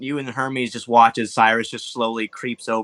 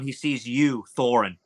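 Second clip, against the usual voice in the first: ordinary talk, nothing marked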